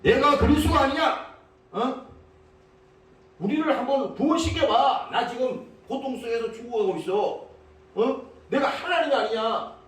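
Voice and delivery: acted scornful demand; mocking taunt. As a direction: taunt sarcastically, acting a bitter criminal. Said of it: "비꼬는 말입니다".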